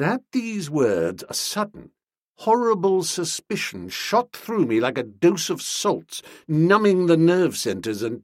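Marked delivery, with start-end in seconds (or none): none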